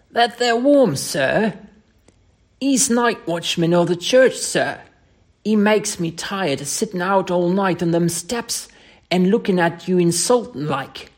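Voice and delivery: indignant Cockney lad, dropping at the apology; Cockney dialect. Acted. Thomas complains to Mister Scott about the watchman, aggrieved Cockney. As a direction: grumble in broad Cockney. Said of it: "Thomas' Cockney dialect".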